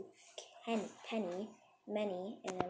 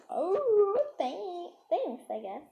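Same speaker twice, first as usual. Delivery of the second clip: higher, louder "Oh" then trailing off unsure; awkward surprised thanks; 6 semitones higher, 7 dB louder, much slower than usual. Retold or imitated. Awkward reply to a backhanded compliment.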